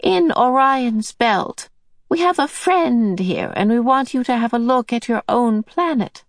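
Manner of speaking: put-on brisk kindly old woman calming a child; Mrs Whatsit. A narrator's reading.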